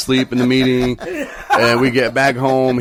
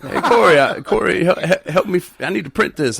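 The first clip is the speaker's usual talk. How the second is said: mock pleading; 9 semitones higher, 4 dB louder than usual. Imitating Tyrone asking for help.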